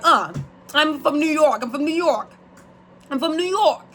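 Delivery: high, repetitive mock New York accent; hammed-up New Yorker, pitched well up; New Yorker imitation. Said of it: "Every New Yorker is cool because they talk like that".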